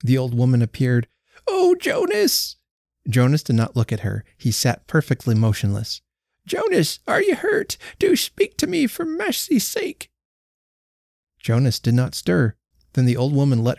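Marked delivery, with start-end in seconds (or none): 1.5-2.6 put-on much higher, slow drawn-out cry of an old wife; dismayed old woman. A narrator's reading. Old wife cries out again. 6.5-10.1 put-on much higher begging old wife, slowing at the end; pleading old woman. A narrator's reading. Old wife begs husband.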